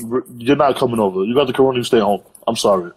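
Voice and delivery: usual speaking voice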